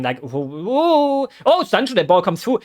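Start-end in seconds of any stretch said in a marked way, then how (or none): 0.2-1.3 high nervous ooh, ooh; anxious whimpering ooh. Real, not acted. Fan fretting as the ball nears his defense, anxious high oohs. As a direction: whimper like you're about to cry. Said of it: "I'm, like, kinda crying".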